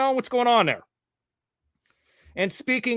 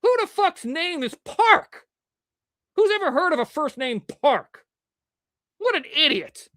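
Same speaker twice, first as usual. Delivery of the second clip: scornful inner voice; 10 semitones higher than usual. Retold or imitated. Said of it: "And I'm thinking to myself".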